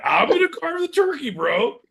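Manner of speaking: high-pitched mock insistence on a job; mock eager friend. Comic mocking his friend who wants to carve the turkey, high mock voice.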